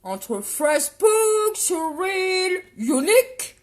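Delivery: English product names with a showy, exaggerated accent; show-off English accent. Cagole showing viewers her blush names, showy English accent. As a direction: draw out the English words in a loud, high put-on accent. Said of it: "vous avez vu un peu l'accent que j'ai?".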